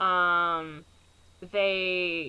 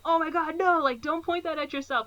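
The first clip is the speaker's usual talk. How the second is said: high-pitched urgent warning, played lightly; mock alarm; 9 semitones higher than usual. Owen Wilson stopping friend with loaded gun, alarmed. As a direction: imitate a friend's alarmed protest.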